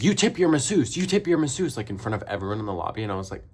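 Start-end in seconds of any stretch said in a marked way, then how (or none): 0.0-1.8 imitating a pushy salon owner, pitched higher and louder; a hammed-up nagging shop owner making a public demand; high insistent mock owner voice. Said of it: "the owner lady was like".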